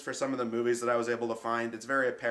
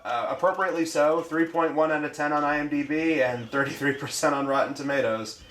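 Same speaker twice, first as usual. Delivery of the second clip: horror trailer voice; 3 semitones higher, 7 dB louder than usual. Acted. Loud hyped reading of synopsis.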